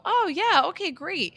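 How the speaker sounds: high, sing-song fake agreement; feigned agreeableness. Humouring someone who gives advice, feigned agreeableness. Acts out politely accepting advice.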